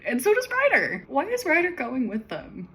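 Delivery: high, loud disbelief; baffled, why is he even there; incredulous. Real, not acted. Reader reacting to Ryder joining, in loud disbelief.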